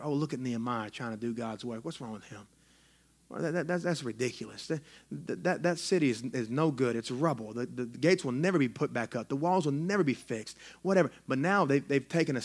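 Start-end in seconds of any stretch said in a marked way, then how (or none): 0.0-11.1 a mock murmur, much softer and lower; sneering under the breath; murmured gossip. Enemies gossiping about Nehemiah, mock murmur. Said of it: "They murmured to each other".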